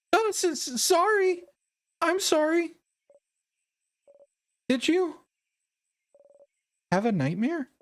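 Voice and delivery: a friend checking on him, concerned; worried cheerful girl. Acted. Voices Mirabelle, apologetic.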